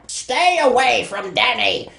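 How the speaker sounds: menacing shadow. Retold or imitated. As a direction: bellow it like a looming phantom.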